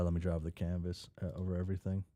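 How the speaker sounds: ordinary delivery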